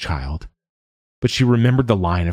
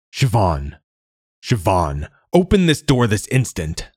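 roaring father; 3 semitones higher than usual. A narrator's reading. Father roaring at Siobhan through the locked door, building to a shout. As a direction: pound on the door and demand it open. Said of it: "her father roared".

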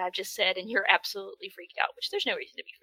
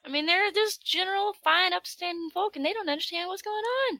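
higher folksy farmer voice; 8 semitones higher than usual. Retold or imitated. S0 voicing farmers, higher folksy voice. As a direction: talk like simple country folk.

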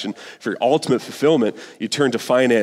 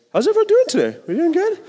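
bright, much higher sing-song hello; cheery greeting; faster than usual. Real, not acted. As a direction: greet the room like a cheerful host.

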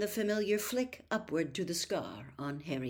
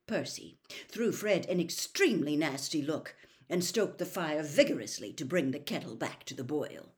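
ordinary delivery

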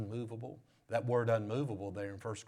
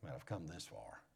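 fast, soft whispered doubt; unsure inner voice, whispered; 9 semitones lower, 14 dB quieter than usual. Acted. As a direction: whisper it like a secret fear. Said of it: "deep down in your heart you're saying".

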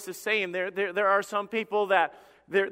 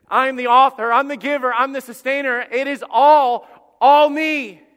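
high, full-force preacher's shout as God; thunderous declaration; 6 semitones higher, 11 dB louder than usual. Acted. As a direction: shout each title, stretching the final words. God's declaration repeated at climax.

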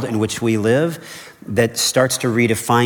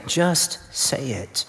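gentle urging; 3 semitones higher, 5 dB quieter, much slower than usual. Real, not acted. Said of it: "Whatever you're gonna say".

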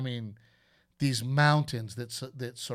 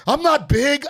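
high, loud defensive shout; taunted drunk yelling back; indignant yell; 10 semitones higher, 9 dB louder than usual. Retold or imitated. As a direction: bristle at the taunt. Drunk guy protesting being taunted.

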